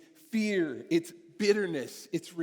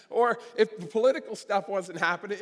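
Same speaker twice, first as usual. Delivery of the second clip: ordinary delivery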